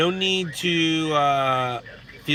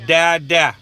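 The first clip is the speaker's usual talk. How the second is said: imitating his son; 7 dB louder, much slower than usual. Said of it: "comes out of my son's mouth".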